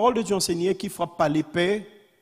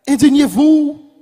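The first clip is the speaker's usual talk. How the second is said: raised, emphatic sermon call; fervent preaching; 8 semitones higher, 9 dB louder than usual. Real, not acted. Preacher urging the faithful against vice, fervent preaching. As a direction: preach it as a ringing call to indignation.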